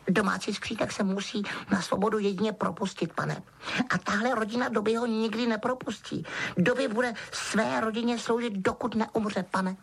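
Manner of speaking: high, deferential elf voice; squeaky little servant reciting his duty; Dobby, dutiful house-elf. A narrator's reading. Elf explaining whom he serves, dutiful.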